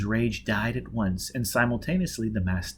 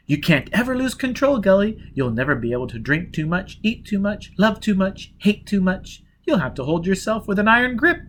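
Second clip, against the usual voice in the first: insistent; 9 semitones higher than usual. A narrator's reading. Gisbella insisting.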